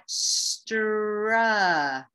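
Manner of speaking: long drawn-out 'stra'; stretched-out syllable. Shown as a demonstration. Teacher echoing the second syllable, slowly stretched out. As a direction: draw out every sound of 'stra'. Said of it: "Let's stretch it out".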